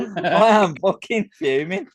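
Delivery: slow, loud, high-pitched outburst; loudly fed up, voice climbing high; fuming, emphatic. Real, not acted. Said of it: "He's getting so angry".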